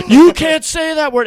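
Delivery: loud, high, drawn-out protest; mock-outraged table. Table scolds the bartender over a word, mock-outraged. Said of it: "And they all go".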